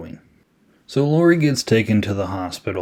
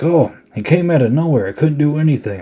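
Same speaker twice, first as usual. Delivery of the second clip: higher, flustered imitation; mock panicked excuse; 6 semitones higher, 3 dB louder than usual. Cop excusing himself after the crash, mock panic. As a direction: make a hammed-up frantic excuse.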